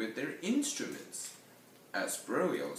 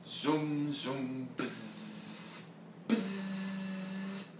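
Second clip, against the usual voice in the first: zooms sinking into buzz, then a higher buzz; buzzing bass; 5 semitones higher, much slower than usual. A narrator's reading. Berlioz bowing his bass in dismay, droned buzzing imitation. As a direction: imitate a faulty bass droning. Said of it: "the buzzing double bass".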